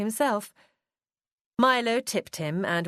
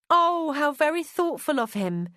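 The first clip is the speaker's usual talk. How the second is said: higher, louder gushing exclamation; delighted cry; 8 semitones higher, 4 dB louder than usual. A narrator's reading. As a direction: cry out in admiration. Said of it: "Winelda cried".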